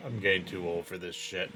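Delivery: low, very soft grumble; world-weary and muted, quickly tossed off; weary grumbling old-timer. Acted. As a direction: grumble it fast under your breath. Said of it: "he seems to be berating".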